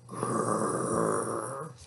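low rolling growl; wolf growl. A narrator's reading. Wolf lurks near the cows, low growl. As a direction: growl deep down like a storybook wolf.

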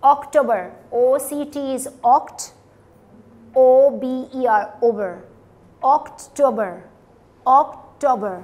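अक्टूबर spelt out, then split as "oct... ober"; loud, slow demonstration of अक्टूबर as ok-TO-ba; broken into syllables. Shown as a demonstration. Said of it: "आप उसको break कर लें". (अक्टूबर को तोड़कर, ज़ोर से)

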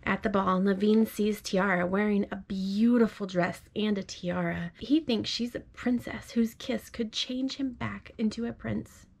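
gentle storybook read-aloud; reading to a child. Acted. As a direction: read it like a bedtime story to your little one. Parent reads picture book aloud.

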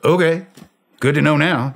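second okay with a laughing, caught-out emphasis; emphatic okay. Retold or imitated. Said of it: "I said".

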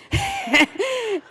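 a quick amused laugh, rising high; short laugh. Real, not acted. Speaker laughs at her own joke about a friend, brief real laugh. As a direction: laugh at the thought of Zoe never coming back.

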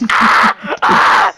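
two loud imitated screams; mock kids' screams. Explaining it's just kids, mock screams. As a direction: scream in short bursts like panicking kids. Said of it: "It's just kids".

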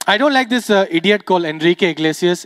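ordinary talk

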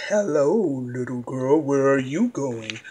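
low, sly fox voice. Acted. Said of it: "The fox said".

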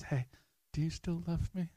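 preacher voicing Jesus tenderly; gentle voice of Jesus.

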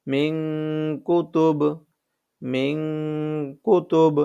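মিং held as a long ng hum before কুতুব; nasal ng pronunciation. Shown as a demonstration. Teacher showing correct মিং কুতুব, long nasal hum. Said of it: "এই যে মিং উচ্চারণ করলাম".

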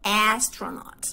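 "astronaut" slow, opening with E-like a; corrected astronaut; slow ash vowel. Shown as a demonstration. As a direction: drag "astronaut" out slowly. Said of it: "decimos E".

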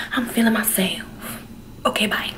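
soft playful whisper. Real, not acted. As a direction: whisper it to yourself. Whispered repeat of own line.

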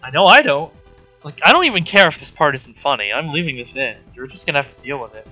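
ordinary talk

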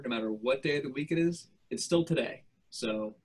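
ordinary talk

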